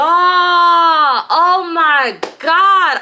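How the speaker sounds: overwhelmed, shocked cry; stunned. Real, not acted. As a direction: wail it out in disbelief. Reacting to the twist.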